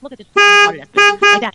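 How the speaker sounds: high, loud sneering mock voice; scoffing, loud and high; mocking quote. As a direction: blurt it out high and loud like a mean aside. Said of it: "and I'm like".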